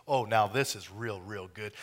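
low, soft, savouring pleasure; God lowers his voice, delighted with Eve. Retold or imitated. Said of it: "and he said".

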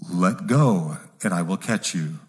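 low, slow, booming voice of God. Acted. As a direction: speak down from heaven, calm and grand. God answers again.

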